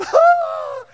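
very high, loud shriek; shriek of dismay. Retold or imitated. Wife watching husband shave his head, shriek. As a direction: imitate the wife's horrified cry. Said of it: "And she's".

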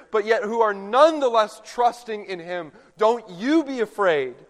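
angel's proclamation. Acted. Pastor voices the angel reassuring the mourners, a loud ringing proclamation. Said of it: "But the angel proclaims".

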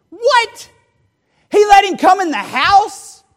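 high, loud indignant yelling; outraged shout. Acted. Older son to the servant about the party, outraged shout. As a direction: shout it like the jilted older brother.

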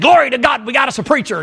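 high, mock-jubilant exclamation; rejoicing grandma. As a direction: imitate a grandma praising God in delight, pitched well up.

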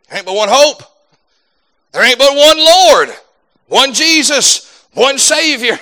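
high, ringing gospel proclamation; full-force preaching. Acted. Preacher declaring one hope, one Lord, fervent preaching.

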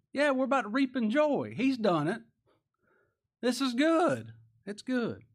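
high, loud rising excitement; joyful preaching. Real, not acted. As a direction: lift them up with joy. Preaching builds to joy.

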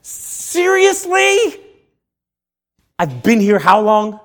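high, drawn-out, exasperated outburst; loud mock indignation, stretching the words; righteous anger. Preacher voicing Jesus upset at the weeping crowd, exasperated. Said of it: "Jesus in his spiritual righteous anger".

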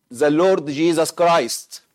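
usual speaking voice

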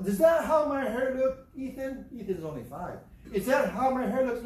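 hammed-up wounded vanity; grandma scolding Ethan over the drawing, hammed-up outrage; mock offended grandma. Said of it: "she doesn't say that at all".

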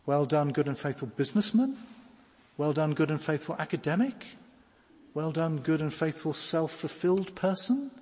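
rising, ironic Well done, good and faithful businessman; mock wrong version. Shown as a demonstration. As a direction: say the wrong ending as if it were praise, then doubt it.

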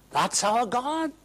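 high declaration of faith; proclaiming. Acted. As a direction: announce it with pride. Repeated proclamation.

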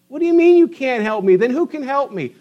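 high, loud and fast, outraged disbelief; indignant demand. Acted. The world protesting to Joseph, indignant and loud. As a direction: push back like you're owed an answer.

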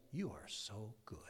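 breathy whisper, very soft; hushed prayer. Acted. Worshipper telling the Lord he is good, whispered. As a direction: whisper it in reverence. Models intimate prayer to God.

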